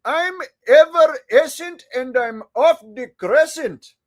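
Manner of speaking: put-on jokey Indian accent read. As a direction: rap it in a hammed-up Indian accent. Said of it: "read it in my Indian voice".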